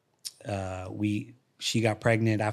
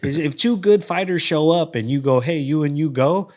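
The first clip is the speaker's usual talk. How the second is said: louder, higher, mocking tone; 6 semitones higher, 8 dB louder than usual. Fighter ridiculing lazy coaches, loud and mocking. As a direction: scoff at coaches who just pair fighters.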